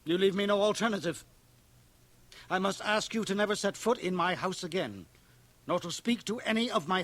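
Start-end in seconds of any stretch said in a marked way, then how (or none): 0.0-3.1 louder clipped finality; stern. Acted. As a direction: shut the door on him. Minister banishes boy.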